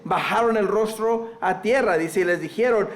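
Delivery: ordinary talk, nothing marked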